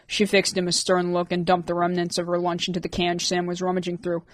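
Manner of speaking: ordinary delivery, nothing marked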